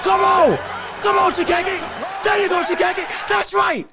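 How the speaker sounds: loud, high cheering from the sidelines; shouting encouragement. Real, not acted. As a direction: yell him forward like a cornerman. Cheering on fighter Takagi.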